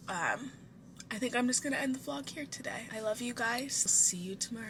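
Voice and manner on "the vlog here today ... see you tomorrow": soft whispered sign-off after crying; whispered goodbye. Real, not acted. Drained vlogger signing off to viewers, whispering. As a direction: barely voice it, drained. Tearful sign-off ending vlog.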